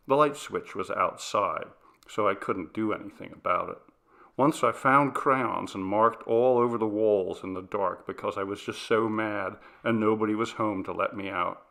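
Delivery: ordinary delivery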